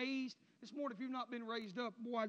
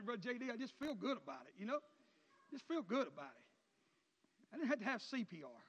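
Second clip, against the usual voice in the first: ordinary delivery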